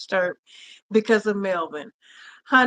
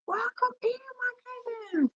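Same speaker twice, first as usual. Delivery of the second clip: softly welcoming family to the stream; tender welcome; 13 semitones higher, 8 dB quieter than usual. Real, not acted. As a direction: welcome them in gently. Welcoming viewers to the live.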